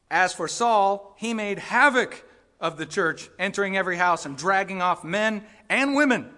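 loud, rising preacher's warning; preaching at full force. Acted. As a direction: drive the warning home to the congregation. Preaches warning against persecution.

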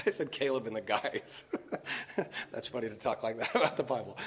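real amused laughter through low, soft words; laughing. Real, not acted. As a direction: chuckle helplessly at your own wording. Amused at own casual wording.

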